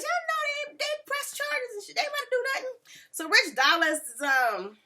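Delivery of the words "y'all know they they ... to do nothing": squeaky sarcastic aside; high mocking tone. Real, not acted. Vlogger mocking castmates who won't fight, high. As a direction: scoff at them in a high voice.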